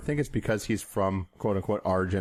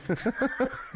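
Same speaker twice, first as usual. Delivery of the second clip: bursts out laughing; high laugh. Real, not acted. Laughing at the clown joke.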